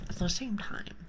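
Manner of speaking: ordinary delivery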